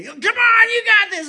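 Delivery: high, loud, slow coaching yell; a loud mocking shout of encouragement. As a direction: bark it from the sidelines. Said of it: "when somebody yelled at me that way".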